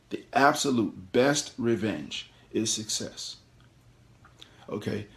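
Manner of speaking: ordinary delivery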